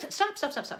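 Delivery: high repeated stop; calling stop. Acted. Puppeteer cuts off the fumbling puppet with high stops. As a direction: halt them, pitch jumping up.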